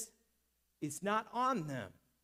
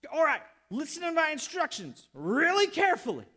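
loud, high, emphatic orders; acted forceful command; commanding instructions; 7 semitones higher, 7 dB louder than usual. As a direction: lay down the orders forcefully, slowing at the condition. Jesus gives man forceful instructions.

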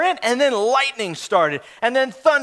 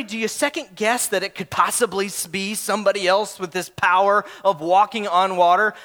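normal talking voice

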